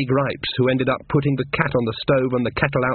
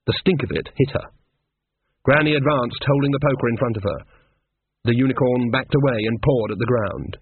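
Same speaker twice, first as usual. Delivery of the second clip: usual speaking voice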